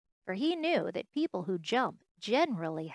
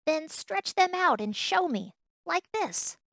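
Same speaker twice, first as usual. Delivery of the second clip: high, loud dare; challenging; 8 semitones higher, 5 dB louder than usual. Acted. Wild rabbit dares the toy to show his legs, challenging. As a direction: throw it out as a challenge.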